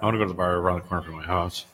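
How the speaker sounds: low, quick, soft mumble; muttered. Real, not acted. Host telling co-host where he wants to go, muttered. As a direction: mutter it fast under your breath. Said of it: "You muttered that".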